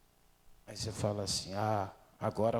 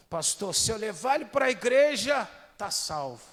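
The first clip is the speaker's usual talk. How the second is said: high, loud, eager plea to the pastor; hopeful believer, higher and louder; 8 semitones higher, 7 dB louder than usual. Retold or imitated. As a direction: pitch it up like a hopeful mother. Voicing a hopeful churchgoer.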